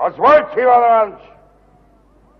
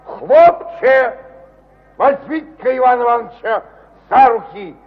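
calling the servants; 6 semitones higher than usual. Acted. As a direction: shout for the servants to drag him out. Calling servants to throw him out.

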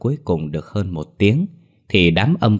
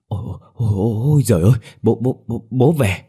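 stuttering in fright, rising higher; frightened stammer; 4 semitones higher, faster than usual. Acted. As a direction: stammer out that the ghost is back. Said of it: "lắp bà lắp bắp nói". (lắp bắp sợ hãi)

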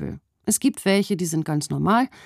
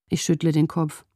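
usual speaking voice

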